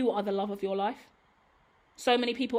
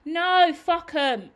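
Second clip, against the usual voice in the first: slow, loud, higher "No, fuck 'em"; slow loud defiant swear; emphatic dismissal; 6 semitones higher, 7 dB louder, much slower than usual. Real, not acted. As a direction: drag out a defiant curse.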